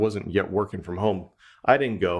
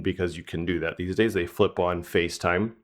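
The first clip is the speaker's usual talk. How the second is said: ordinary delivery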